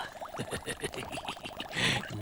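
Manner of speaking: breathy villain snicker; evil whispered cackle. Acted. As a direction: snigger under your breath, scheming. Villain laughing.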